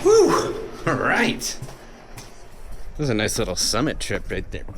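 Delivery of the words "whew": very high loud whew; exhilarated whew; relieved whew. Real, not acted. Reacting to the summit.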